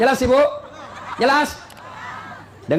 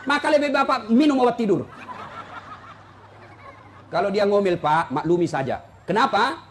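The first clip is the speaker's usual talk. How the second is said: ordinary talk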